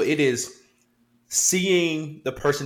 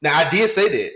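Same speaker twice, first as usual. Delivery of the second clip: laughing dad reply; 3 semitones higher, 3 dB louder than usual. Retold or imitated. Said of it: "I be like".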